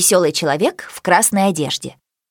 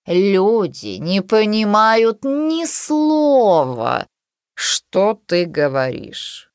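scornful cat voice; much slower than usual. A narrator's reading. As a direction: drawl it like a haughty cat. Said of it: "сказала она".

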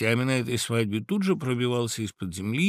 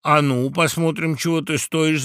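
raised, louder taunt from the crowd; higher, goading cry; challenging shout; 5 semitones higher, 5 dB louder than usual. A narrator's reading. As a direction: call out a dare. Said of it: "закричали опять птицы".

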